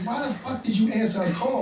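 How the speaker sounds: mock outrage at the man who answered; retold anger. Prisoner fuming at whoever answered her phone, retold anger. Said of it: "I was so mad".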